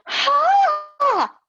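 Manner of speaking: shrieking high gasp of shock, then fading to worried muttering; mock shock. Yoga teacher retelling shock at the till price. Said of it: "I'm going".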